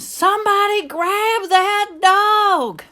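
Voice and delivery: high, drawn-out scream of alarm. A narrator's reading. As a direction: scream it slowly as the frantic store manager. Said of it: "The manager screamed".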